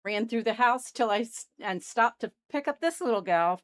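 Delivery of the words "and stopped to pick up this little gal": normal talking voice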